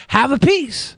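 high, offering a snack in character; goofy mascot handing out food; shark mascot voice. Pretend. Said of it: "holds the tray up and is like".

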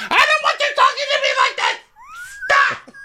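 extremely high, quick mock shriek; Tamra meltdown. Housewife telling the hostess to stop, mock-shrieking. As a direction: squeal it out, hammed-up hysteria. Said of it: "that Tamra voice that I ca-, I can't do".

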